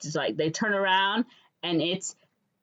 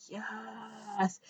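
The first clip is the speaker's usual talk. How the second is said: much softer, drawn-out yes; hushed yes; 4 semitones higher, 11 dB quieter than usual. Acted. Viewer awaiting the kiss, hushed delight. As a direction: savor it under your voice. Imagined reaction to coming kiss.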